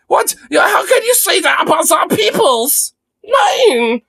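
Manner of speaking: shrill falsetto shrieking with a German "nein"; mock hysterical German woman. Streamer parodies a Nazi-era woman scolding protesters, in a shrill mock falsetto. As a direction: screech it like an outraged Aryan lady. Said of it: "this weird Aryan broad over here being like".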